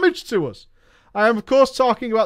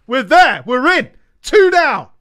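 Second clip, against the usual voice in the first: triumphant shout, higher and louder; hoarse victory roar; 4 semitones higher, 6 dB louder than usual. Real, not acted. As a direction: yell out the win. Victory after seventh try.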